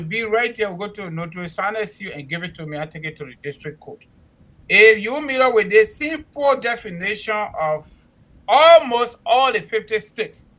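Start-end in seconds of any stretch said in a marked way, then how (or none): 4.7-10.5 emphatic. Real, not acted. Stressing the definition to callers.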